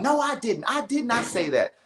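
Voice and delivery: imitated yelling. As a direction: imitate her protesting in a high voice. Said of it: "she's out here yelling".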